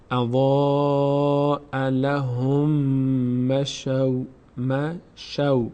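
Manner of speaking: اضاء لهم مشوا recited slowly, each sound drawn out; slow tajwid recitation. Shown as a demonstration. Teacher recites slowly for students to repeat. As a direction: model the recitation syllable by syllable. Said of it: "ضمه رو میچسبونیم به میم".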